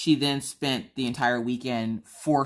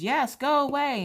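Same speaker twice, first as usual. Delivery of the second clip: put-on annoyed kid voice; 14 semitones higher than usual. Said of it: "And he goes".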